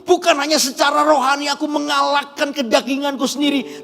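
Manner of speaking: loud, high declaration of faith; full-force preaching. Acted. Preacher declares the decision to the church, full-force preaching. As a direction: proclaim it to the whole congregation.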